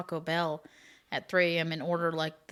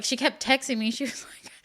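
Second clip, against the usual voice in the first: ordinary talk, nothing marked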